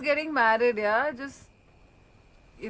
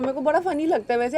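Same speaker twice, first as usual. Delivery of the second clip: ordinary talk, nothing marked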